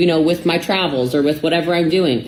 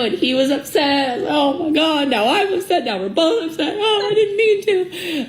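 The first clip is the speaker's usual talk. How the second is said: rising distress; 12 semitones higher than usual. Real, not acted. Upset retelling, voice climbs.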